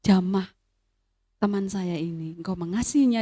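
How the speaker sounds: soft, low murmured prayer over a friend; gentle whispered-down plea to God; hushed prayer. Retold or imitated. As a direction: pray quietly, hand on her chest. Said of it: "dan saya berdoa".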